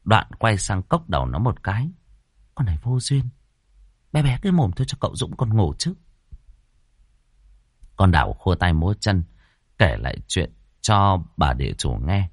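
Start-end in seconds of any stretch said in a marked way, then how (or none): 2.5-5.9 higher-pitched, quick scolding, kept down; hushed scolding. A narrator's reading. Landlady shushes maid while baby sleeps, scolding. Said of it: "lệnh cho nó bé bé cái miệng".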